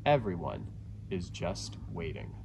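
ordinary delivery

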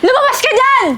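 high, insistent angry call; loud calling out. Acted. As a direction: holler for the rival to come out. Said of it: "ang lakas ng boses ng babaeng to". (pasigaw na pagtawag nang galit)

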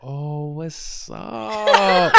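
goofy, over-long high greeting; weird greeting. Retold or imitated. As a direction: drag the words out absurdly, swelling at the end. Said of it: "I did some, like, weird".

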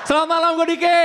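high, loud stage hello; shouted greeting. Real, not acted. Comedian shouts good evening to the audience, high. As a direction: belt the sign-off to the crowd.

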